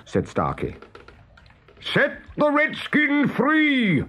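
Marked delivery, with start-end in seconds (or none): 1.8-4.0 high, slow commanding call; Hook imitation. A narrator's reading. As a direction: call the order as the pirate captain. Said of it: "called Peter".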